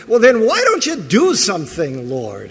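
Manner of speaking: loud, high demand; mock impatient cry to God; loud demanding prayer. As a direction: demand he act, exasperated. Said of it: "second half of that prayer".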